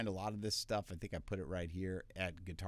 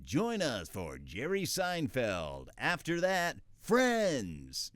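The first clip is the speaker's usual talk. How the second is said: bright, punchy network promo read, higher and louder; energetic TV promo announcer; 6 semitones higher, 4 dB louder, much slower than usual. Shown as a demonstration. Voice actor demoing a TV promo, energetic announcer. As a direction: sell the sitcom lineup like a TV promo. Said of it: "something that's energetic".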